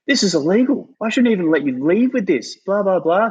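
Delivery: high, squeaky outraged clerk voice; mocking the shop guy. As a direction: squeal it like a fussy jobsworth. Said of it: "He goes".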